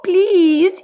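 high, pleading little kitten voice; pleading kitten cry. A narrator's reading. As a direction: beg like a small kitten. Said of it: "he cried".

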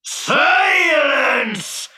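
very high, drawn-out genie shout; bawled command. A narrator's reading. Genie silences the fisherman, bawling. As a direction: bawl it to shut him up. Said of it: "bawled the genie".

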